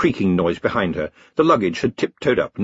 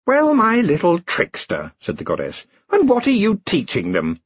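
goddess voice; 9 semitones higher than usual. A narrator's reading. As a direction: purr down at him like a goddess. Goddess addresses Rincewind.

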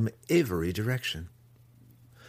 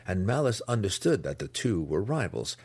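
plain reading voice

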